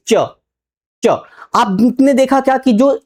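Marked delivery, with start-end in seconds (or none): 0.1-1.1 च said alone, twice, as a clear tongue-to-palate sound; demonstrating च. Shown as a demonstration. Teacher shows students how to say च, clear demo. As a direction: sound out च slowly for the class. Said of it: "चेष्टा करिए".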